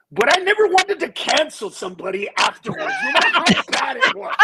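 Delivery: loud, high, laughing shout; shouted punchline. Real, not acted. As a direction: belt the punchline while cracking up.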